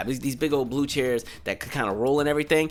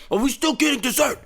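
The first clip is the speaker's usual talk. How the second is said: put-on high, innocent child voice; a kid who only cares about dessert; clueless kid; 9 semitones higher than usual. Pee asking the teacher about dessert, high innocent kid voice. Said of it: "Pee was like".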